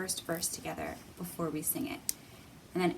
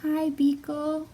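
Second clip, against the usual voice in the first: a hint of a higher little girl's voice; child voice; 6 semitones higher, 6 dB louder, slower than usual. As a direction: greet him shyly but happily. Alice greets Beekle in story.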